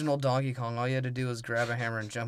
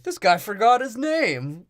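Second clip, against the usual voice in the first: high, loud, drawn-out jeer; mocking announcement; 10 semitones higher, 8 dB louder, much slower than usual. Pirate ridiculing Sparrow to the others, mocking shout. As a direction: announce it mockingly to the whole crew.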